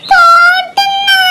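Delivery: stunned and about to cry; shocked, tearful. Acted. Little girl stunned by grandma's tale, tearful disbelief.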